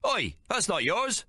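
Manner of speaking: high, slow indignant waiter; mock cockney waiter protest; waiter's protest. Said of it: "says the waiter".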